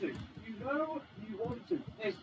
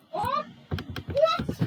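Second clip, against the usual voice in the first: very high, loud, rising question; astonished yelp; 10 dB louder than usual. Real, not acted. Player stunned by the car's move, astonished yelp. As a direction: yelp it higher and louder each time.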